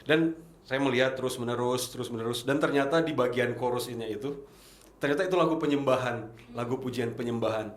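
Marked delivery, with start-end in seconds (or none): none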